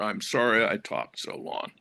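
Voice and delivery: normal talking voice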